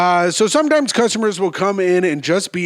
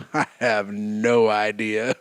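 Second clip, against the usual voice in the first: low, slow, sedated drone; on Valium; 8 semitones lower, 5 dB quieter, slower than usual. Retold or imitated. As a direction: answer slowly and softly, doped-up. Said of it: "when I was on Valium".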